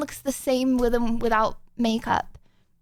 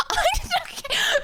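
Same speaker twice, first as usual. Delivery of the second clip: laughing; slower than usual. Real, not acted. Laughing while brushing off comment.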